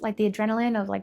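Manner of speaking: normal talking voice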